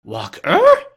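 very high, loud disbelief; boy's exclamation. A narrator's reading. Boy disbelieving Scrooge's order, high exclamation. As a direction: squawk it as an incredulous boy. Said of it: "exclaimed the boy".

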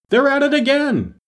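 high, sustained cry at full pitch; very high-pitched yell falling at the very end; shouting. A narrator's reading. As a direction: call it out as if at the top of your voice. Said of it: "simply shouted at the top of his voice".

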